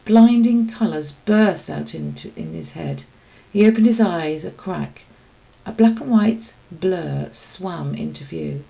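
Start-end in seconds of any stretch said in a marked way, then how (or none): none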